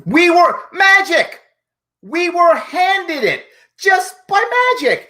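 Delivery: high, loud mock wonder; mock amazement. Commentator mocking the governor's inherited infection rate, mock amazed. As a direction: exclaim it as a ridiculous marvel.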